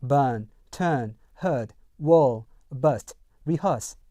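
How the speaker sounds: British accent, no R in turn, herd, rehearse; British non-rhotic. Shown as a demonstration. Teacher lists words for learners, British non-rhotic accent. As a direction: drop the R in each word, British style. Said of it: "영국 영어에서는요".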